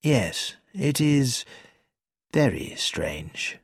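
quiet, slow, sombre agreement; quietly. A narrator's reading. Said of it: "Mister Entwistle said quietly".

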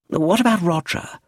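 high, insistent question. A narrator's reading. Edith pressing the lawyer about Roger, insistent. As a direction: press the point firmly. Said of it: "insisted Edith de Havilland".

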